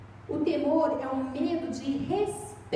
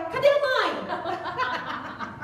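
very slow, laughing through the words; can't get the line out for laughing; laughing while voicing; 4 semitones higher, much slower than usual. Preacher laughing while voicing the daughter asking for mom.

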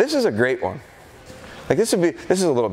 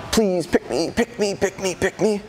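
a hint of a pleading dog, higher; eager dog's voice; 6 semitones higher than usual. Dog straining to be chosen, lightly voiced eager pleading. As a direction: beg to be picked like an eager pup. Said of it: "Reaching out".

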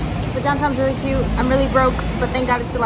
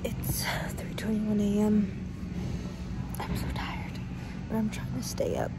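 whispering; 11 dB quieter than usual. Real, not acted. Alone in airport at 3 a.m.

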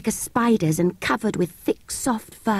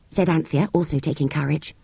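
usual speaking voice

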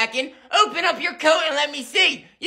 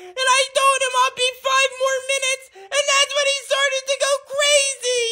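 put-on high whining tattling boy; whiny kid; 9 semitones higher than usual. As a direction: whine it to Mom.

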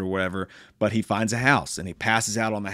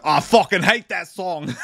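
annoyed captive; 7 semitones higher, 6 dB louder than usual. Retold or imitated. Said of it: "He's like".

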